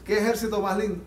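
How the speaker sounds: normal talking voice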